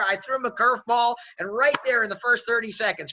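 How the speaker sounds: ordinary talk, nothing marked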